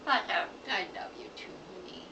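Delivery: soft breathy whisper. Real, not acted. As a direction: whisper it tenderly, close. Cooing goodnight to bird.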